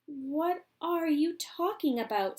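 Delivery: baffled; Missus Bucket. Acted. Missus Bucket asks Charlie, baffled. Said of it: "said Missus Bucket".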